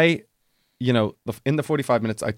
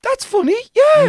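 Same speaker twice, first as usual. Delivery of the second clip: hammed-up high, nervous falsetto; awkward squeak; 8 dB louder than usual. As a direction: stall in a loud, squeaky mock panic. Awkward reply to pupil's 'Africa'.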